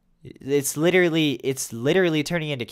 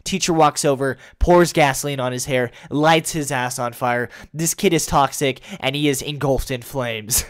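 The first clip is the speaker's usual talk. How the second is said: ordinary delivery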